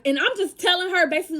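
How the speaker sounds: ordinary talk, nothing marked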